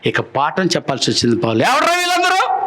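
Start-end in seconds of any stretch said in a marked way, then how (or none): 1.6-2.6 much higher, indignant outburst; angry Paul. Acted. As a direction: demand it in acted anger, pitched high. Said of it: "అంత పెద్ద కోపం వచ్చింది". (కోపంగా, హెచ్చు స్వరంతో నిలదీస్తూ)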